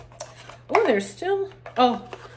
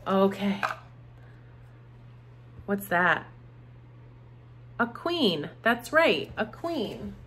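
ordinary talk, nothing marked

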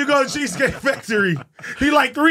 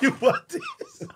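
dad's threat mocked; slower than usual. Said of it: "My dad be like".

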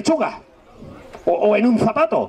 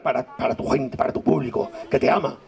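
softly coaxing; 8 semitones lower, 5 dB quieter than usual. Acted. Coaching girl to face audience.

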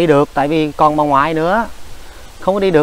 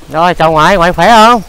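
loud, raised-pitch question to grandma; calling out; 3 semitones higher, 8 dB louder than usual. Real, not acted.

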